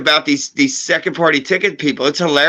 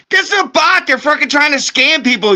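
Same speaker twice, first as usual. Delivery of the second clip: much higher, exasperated rant; indignant protest; 8 semitones higher than usual. Retold or imitated. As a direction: plead your case, outraged. Arguing with Facebook moderation.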